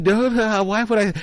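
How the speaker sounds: high-pitched mock innocence; imitated protesting denial. Scammer denying it to his customers, high imitated protest.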